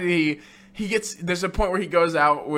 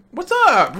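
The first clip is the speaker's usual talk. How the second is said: high, loud breezy hello; mock chipper Mathis; mock casual greeting; 9 semitones higher, 5 dB louder than usual. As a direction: bounce in cheerfully.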